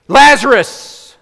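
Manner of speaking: a huge booming call, drawn out; Jesus shouting into the grave for Lazarus; commanding call. Acted. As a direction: call him out of the tomb with full force.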